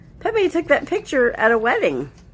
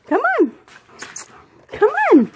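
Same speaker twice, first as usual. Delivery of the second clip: high excited coaxing; 13 semitones higher, 5 dB louder than usual. Real, not acted. High excited urging of dog.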